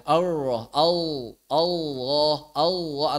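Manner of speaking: "Allah" with the lam turned to w, like "Aoh"; lisped Allah. Shown as a demonstration. Teacher imitating a lisped lam students make. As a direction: slur "Allah" to an "aoh" sound. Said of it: "cadel ya".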